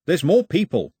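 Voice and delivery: high, loud, exasperated cut-in; impatient urgency. Acted. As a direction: cut him off and urge him along. Mao cuts off the gloating.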